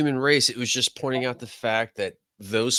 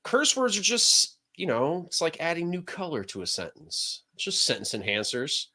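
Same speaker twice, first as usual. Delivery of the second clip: quoting his father; 5 semitones higher, 6 dB quieter than usual. Retold or imitated. Telling cohosts what his father said, lightly voiced dad. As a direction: lightly voice dad's advice, fading softer. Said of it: "Jim Fox used to tell me".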